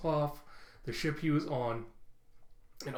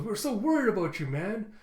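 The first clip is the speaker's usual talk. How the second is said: higher, louder gushing worry; mock concerned outburst; 7 semitones higher, 5 dB louder than usual. Crew greeting Jinbei after worrying about him, mock concern. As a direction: blurt it out with exaggerated relief.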